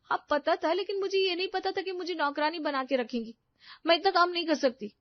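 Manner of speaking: upset protest. Acted. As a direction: refuse him with hurt and anger. Wife confronts husband. (पति से दुखी होकर ऊँचे सुर में विरोध)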